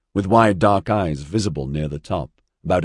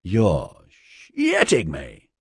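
slow, drawn-out disbelief; incredulous exclamation; much slower than usual. A narrator's reading. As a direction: stretch the words out in disbelief. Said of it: "Ozzy exclaimed".

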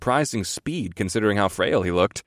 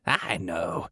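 put-on softer old man, patiently exasperated; weary parent humoring a child; exasperated placating; 3 semitones lower, 8 dB quieter than usual. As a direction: placate him like a toddler. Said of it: "sounded like an exasperated parent placating a toddler".

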